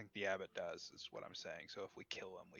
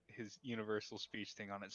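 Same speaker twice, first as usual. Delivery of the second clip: normal talking voice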